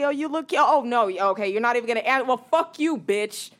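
mock come-on building to loud insults; high, increasingly loud acted catcaller; catcaller turning abusive. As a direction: start sweet-talking, then lash out when rejected. Acts catcaller turning from flattery to abuse.

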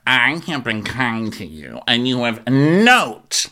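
slow put-on accent, slipping Canadian; drawn-out affected accent, overdone; Meredith. Mimicking Meredith on kindness, slow accidental Canadian accent. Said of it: "where did that Canadian accent come from".

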